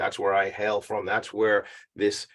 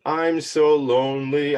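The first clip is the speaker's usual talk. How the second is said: mock lonely whine; 4 semitones higher, 4 dB louder, slower than usual. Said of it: "you're lonely".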